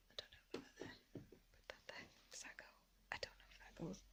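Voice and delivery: barely audible whisper to herself. Real, not acted. Arranging ornaments on the shelf, whispering to herself. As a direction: whisper it under your breath, placing things.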